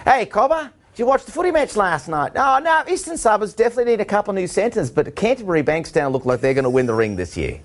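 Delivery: hammed-up Australian footy fan accent. Shown as a demonstration. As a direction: show off the Aussie accent he grew up with. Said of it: "Give us a little taste".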